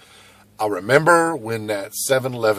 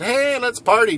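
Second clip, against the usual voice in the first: high, cheery mock invite; hammed-up buddy calling you out; imagined friend, high and cheerful; mock party invite; 14 semitones higher, 8 dB louder than usual. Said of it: "came around and said".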